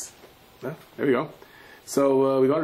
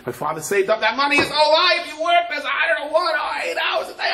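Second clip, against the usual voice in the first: high, loud, anguished outcry; despairing cry; 4 dB louder than usual. Acted. Daughter crying out over her father's lost savings, high and loud. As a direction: wail it in despair.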